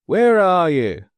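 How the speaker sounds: loud, slow call; shouted. Acted. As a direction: shout into the night. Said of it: "shouted Andrew".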